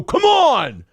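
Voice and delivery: whiny mock shout, pitch jumps up; mock yell. Mocking challengers who demand a fight, yelled. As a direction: mimic the challengers demanding a fight, yelling "Come on!". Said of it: "These guys go".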